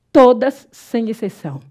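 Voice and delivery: "Todas" hit loud and long, then "sem exceção" dropped back down; emphatic todas. Shown as a demonstration. Teacher insisting to students that all proparoxítonas take accents, loud on "Todas". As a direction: punch "Todas" hard to leave no doubt. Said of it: "Todas, todas são acentuadas".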